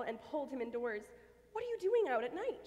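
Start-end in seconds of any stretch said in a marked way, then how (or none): 1.5-2.7 higher, louder, alarmed; Sally scolding Squeak about night danger, alarmed; alarmed cry. Acted. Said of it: "she cried".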